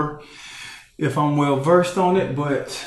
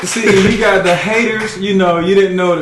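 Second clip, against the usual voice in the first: excited; 6 semitones higher, 6 dB louder than usual. Real, not acted. Hyping the live audience.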